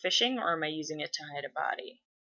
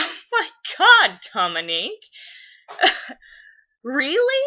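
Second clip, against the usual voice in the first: imitated mom cracking up, high and loud; laughing disbelief; 8 semitones higher, 9 dB louder than usual. Mom laughing at daughter's late realization, incredulous. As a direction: laugh through mock-incredulous exclamations.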